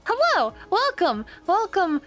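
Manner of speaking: high, bright-pitched greeting; sing-out hello, pitched high; excited welcome. Real, not acted. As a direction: greet a crowd that just walked in. Greeting newly arrived raiders.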